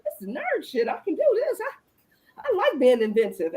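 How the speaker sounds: high, eager self-talk; thrilled discovery; bright mock-excited inner voice; excited realization. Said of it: "I was like".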